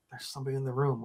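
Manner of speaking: soft, low statement; hushed warning. Retold or imitated. Paul warns his sleepy roommate, hushed statement. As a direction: break the news under your voice.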